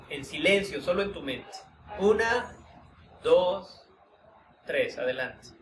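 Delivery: calm, unhurried guiding voice with long pauses; guided meditation. Acted. Teacher guiding students through a voice-tone exercise, meditative. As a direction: lead the group inward through the exercise, gently.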